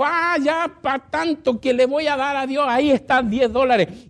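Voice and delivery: slow, high, grudging mock sigh; mock grudging giver. Pastor imitating a stingy giver, mock grudging. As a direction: toss off the offering like a stingy man. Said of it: "Y un día dijo".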